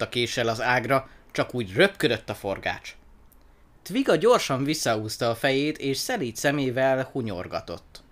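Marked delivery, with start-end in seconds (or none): none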